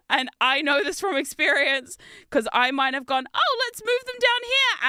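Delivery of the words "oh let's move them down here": playful high coax. Retold or imitated. Retold playful suggestion to child.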